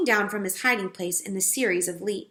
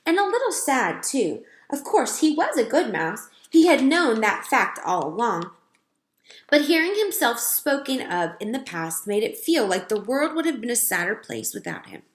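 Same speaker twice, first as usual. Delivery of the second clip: plain reading voice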